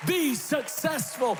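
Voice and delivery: shouting the promise to the crowd; preaching at full force. Acted. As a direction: build it like a Sunday climax. Preaching climax over music.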